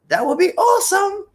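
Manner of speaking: squeaky-high, upbeat exclamation; high-pitched enthusiasm. Real, not acted. Host urging viewers to comment, high-pitched enthusiasm. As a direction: leap up very high and hold it there.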